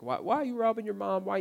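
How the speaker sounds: high, loud pressing why; sponsor's question. Retold or imitated. Mentor grilling him about robbing mom, pressing loud high question. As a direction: push him hard for an answer.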